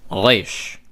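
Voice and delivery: Resh with a throaty French R at the back. Shown as a demonstration. Teacher showing modern Israeli Resh. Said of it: "like the French R".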